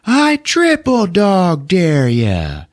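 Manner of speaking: high, slow, drawn-out challenge; mock yell. Schwartz issuing the ultimate dare, drawn-out mock yell. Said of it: "Schwartz then yells out".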